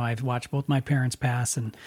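ordinary talk, nothing marked

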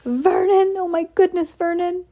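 high alarmed cry; wife crying out to her husband in shock. Acted. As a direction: gasp his name out in alarm. Petunia choking in shock.

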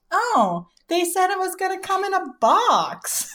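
high, breezy mock mom voice; imitating mom. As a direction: play his mom lightly, pitched well up. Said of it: "she was like".

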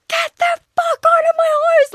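very high, loud drawn-out screech; screaming. Retold or imitated. Girlfriend throwing her ex out, screaming. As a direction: re-enact losing it at full pitch. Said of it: "Like, I was screaming".

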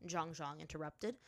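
plain reading voice

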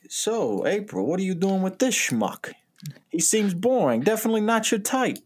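high, sliding Italian-American sleaze. Acted. [name] hitting on April at dinner, put-on high Italian voice. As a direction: hit on her in a put-on Italian voice. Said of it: "I keep doing an Italian voice".

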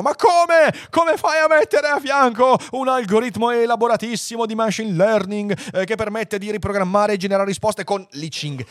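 high, loud mock outrage; imagined angry engineers. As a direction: protest like an indignant engineer, hammed up. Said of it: "si arrabbino gli ingegneri che dicono". (finta indignazione, acuta e forte)

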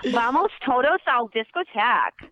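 Spanish in a flat Minnesotan accent, slow. Shown as a demonstration. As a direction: speak Spanish flatly like a Minnesotan, slowly. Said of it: "It's so flat".

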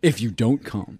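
playful dramatic announcer; trailer voice. Shown as a demonstration. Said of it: "You have, like, the greatest trailer voice".

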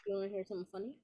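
distant, off-mic voice; far from mic. Real, not acted. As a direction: sounds far from the microphone. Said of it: "you sound, like, mad far away right now".

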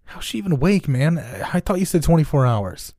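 high, startled question; can't believe she's awake; alarmed. Acted. Henchman asking John why the girl is awake, alarmed.

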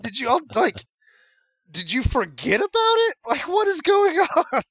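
high, loud disbelief; incredulous. Retold or imitated. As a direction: shriek in disbelief at them. Speaker's retold disbelief.

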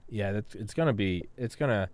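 ordinary talk, nothing marked

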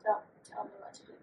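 a mock-secret whisper to her cohost; whispered repeat. Real, not acted. To her cohost, repeating the line as a playful whisper. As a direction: breathe it out as a whisper, quick.

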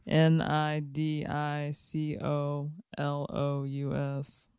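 letters spelled out slowly; spelling it out. Shown as a demonstration. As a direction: spell each letter one by one. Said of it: "spell it for the people".

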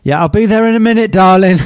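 loud, fast, high call to a spouse; mock reply to spouse. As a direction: call out sweetly to an offstage wife. Pretend reply to partner calling.

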